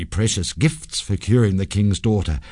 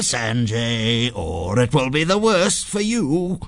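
menacing phantom, pitched up; evil ghost; 6 semitones higher than usual. A narrator's reading. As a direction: gloat and threaten like a wicked spirit. Evil ghost threatens Sanjay.

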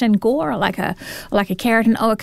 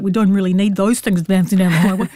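put-on Kiwi accent; 4 semitones lower than usual. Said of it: "I don't know if that's a Kiwi accent or not".

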